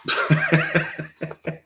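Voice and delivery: real high, light chuckle; laugh. Real, not acted. Player amused after a scene, real chuckle. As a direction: laugh in a quick run of bursts.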